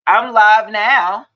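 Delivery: loud, drawn-out call. Real, not acted. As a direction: holler it slowly to the caller. Calling out to caller while live.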